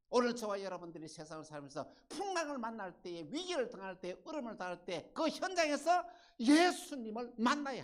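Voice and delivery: building to a high, loud climax; impassioned pulpit exhortation; full-force preaching. Real, not acted.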